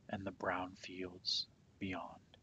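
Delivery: ordinary delivery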